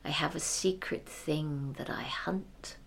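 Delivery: hushed, conspiratorial; secretive whisper. Acted. Hunter confides secret.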